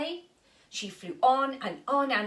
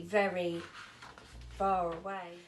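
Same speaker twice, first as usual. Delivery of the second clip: plain reading voice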